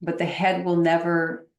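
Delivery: usual speaking voice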